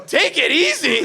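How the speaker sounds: disbelieving, high-pitched repeat; incredulous echo. Real, not acted. Comic echoing a buddy's warning, incredulous. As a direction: throw the words back in disbelief.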